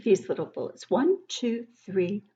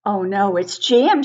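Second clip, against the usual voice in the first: shout; 7 dB louder than usual. Acted. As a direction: shout that the door is stuck. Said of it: "shouts Dan".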